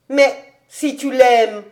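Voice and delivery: slow, emphatic exclamation; exclaiming. A narrator's reading. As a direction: cry out in earnest protest. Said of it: "s'écria-t-il".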